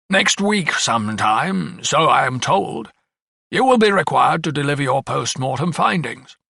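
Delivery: a hint of a measured, official coroner; coroner figure.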